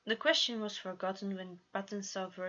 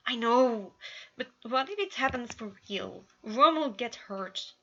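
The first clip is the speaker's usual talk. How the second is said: frustrated outburst; 4 semitones higher, 8 dB louder than usual. A narrator's reading.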